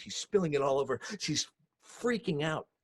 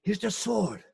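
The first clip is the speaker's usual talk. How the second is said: louder, higher fatherly plea; father calming mama-san; 8 semitones higher, 7 dB louder than usual. Retold or imitated. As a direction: talk her down, rising as you go. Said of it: "And he say".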